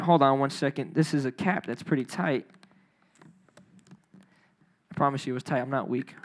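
ordinary delivery

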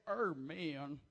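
low, drawn-out goofy amen; silly put-on voice. Shown as a demonstration. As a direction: drop into a low comic voice. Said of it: "I have no idea where these voices are coming".

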